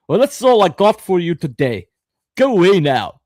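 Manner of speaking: Scottish accent impression. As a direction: do the critical drinker's Scottish accent, a bit loud. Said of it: "he's got a very distinctive Scottish accent".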